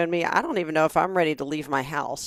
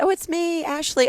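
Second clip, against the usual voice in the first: acquaintance reply; 10 semitones higher than usual. Retold or imitated. Acquaintance answering in retold scene.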